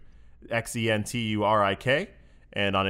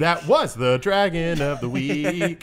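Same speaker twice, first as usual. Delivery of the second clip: outro announcer; 5 semitones higher, 3 dB louder, slower than usual. Pretend.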